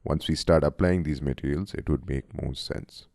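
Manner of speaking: normal talking voice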